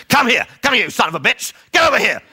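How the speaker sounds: high, loud furious shouting at the dog; angry yelling. Retold or imitated. Frustrated owner yelling for the dog, high and loud. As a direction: bellow it like the dog has ignored you forever.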